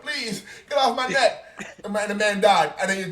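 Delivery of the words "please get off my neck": high, slow strained plea; retold desperate plea; pleading. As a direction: beg for air, pitched way up. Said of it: "He was like".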